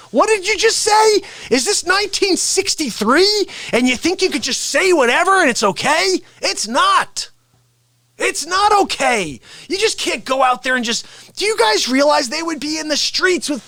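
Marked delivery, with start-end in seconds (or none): 0.1-11.3 mock outrage. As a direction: play up a scandalised scold. Mock outrage at a double standard.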